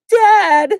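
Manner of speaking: high loud cry for her dad; anguished cry. Acted. Glacia crying out for her lost dad, loud.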